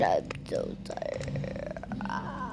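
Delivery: soft, slow, breathy sigh of words; worn-out murmur; exhausted. Acted. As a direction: drag it out in fatigue. Exhausted after ordeal.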